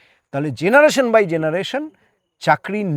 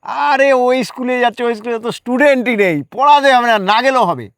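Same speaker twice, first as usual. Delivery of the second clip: higher, louder, dismissive mock cheer; mocking colleagues; 6 semitones higher, 7 dB louder than usual. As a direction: imitate colleagues brushing it off with a jeer. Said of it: "তাকে সবাই শুভেচ্ছা জানিয়ে বলল".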